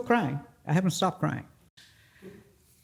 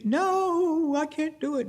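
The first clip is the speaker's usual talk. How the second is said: much higher, whimpering no; stuck on the rock, whining back at her friend; tearful refusal; 11 semitones higher than usual. Retold or imitated. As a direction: plead like a scared crying child. Frozen climber refusing, crying.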